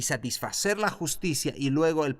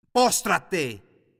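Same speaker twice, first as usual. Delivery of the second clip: loud, high command to bow down; commanding shout; 4 semitones higher, 5 dB louder than usual. Acted. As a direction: order him to his knees. Jesús ordena a Satanás. (orden tajante y fuerte a Satanás)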